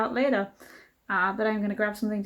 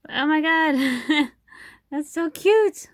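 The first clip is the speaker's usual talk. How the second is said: excited; 6 semitones higher, 5 dB louder, slower than usual. Real, not acted. Delight over new pins.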